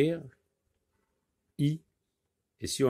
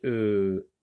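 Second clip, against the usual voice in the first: usual speaking voice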